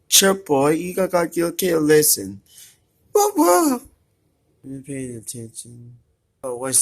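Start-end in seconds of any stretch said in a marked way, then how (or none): 3.1-3.8 squeaky high-pitched whoa. Pretend. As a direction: squeal it like a startled cartoon. Reacting to game character.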